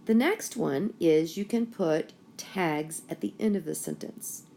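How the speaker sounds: ordinary talk, nothing marked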